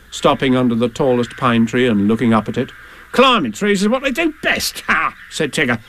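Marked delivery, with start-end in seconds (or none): 3.1-5.1 higher, bouncy character voice; raised, blustery animal character; Tigger's voice. Acted. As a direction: bluster it like a confident braggart. Tigger boasts about climbing.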